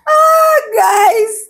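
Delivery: high, loud wail winding down from laughter; amused high exclamation. Real, not acted. Vlogger to viewers after laughing, high wail. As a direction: let out a high, drawn-out cry.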